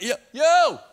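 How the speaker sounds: high, urgent call for attention. Pretend. Believer calling out to God, urgent. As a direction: get God's attention fast. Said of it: "been like".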